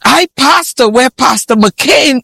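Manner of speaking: mock self-important boast. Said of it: "I tell everybody".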